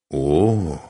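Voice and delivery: long, lingering "oh"; drawn out. A narrator's reading. Man feeling her warm belly, drawn out. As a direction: stretch the vowel appreciatively. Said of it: "протянул Машек".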